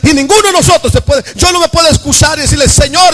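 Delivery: fast, loud, driving sermon delivery; full-force preaching. Acted. Preacher urges the church to obey God, loud and fast. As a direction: hammer it home to the congregation.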